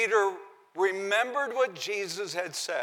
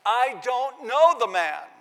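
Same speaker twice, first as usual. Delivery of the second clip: higher, louder, slow furious denial; cursing denial; 7 semitones higher, 8 dB louder, slower than usual. Retold or imitated. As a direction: hurl it out with curses. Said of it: "as he cusses and curses".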